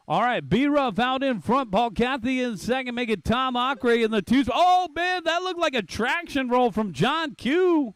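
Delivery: fast excited race call; play-by-play. Acted.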